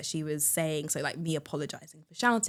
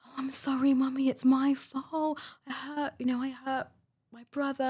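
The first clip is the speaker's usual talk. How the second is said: put-on higher, whispery little-child apology; imitating a toddler; 8 semitones higher than usual. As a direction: play the guilty two-year-old. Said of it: "she was saying".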